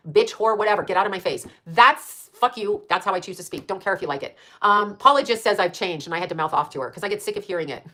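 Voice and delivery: ordinary talk, nothing marked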